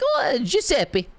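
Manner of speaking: put-on high, squeaky Italian accent; hammed-up old Italian deli worker; old Italian deli man. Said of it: "an old Italian dude working in a Boston deli".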